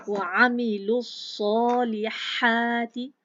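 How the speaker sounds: slow loud recitation, small alif held two beats; tajweed recitation. Shown as a demonstration. Teacher recites the next phrase for students, slow chant. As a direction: chant it out slowly. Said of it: "अलिफ सगीरा है".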